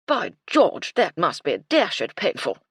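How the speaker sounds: put-on loud, hearty old officer; blustering colonel. Colonel welcoming afternoon tea, loud blustering cheer. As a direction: boom it like a cheerful old soldier.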